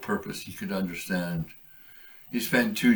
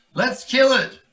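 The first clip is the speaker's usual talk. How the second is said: high, loud, eager war cry; rally the others to attack; eager kid shout; 9 dB louder than usual. Acted. Young kit shouting to kill the monster.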